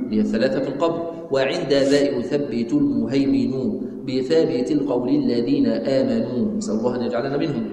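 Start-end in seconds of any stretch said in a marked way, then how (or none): 1.3-6.6 measured, drawn-out chanting of the poem; slow verse recitation. Acted. Teacher reciting the creed poem to students, slow chant. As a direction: give each line of the poem its full slow weight.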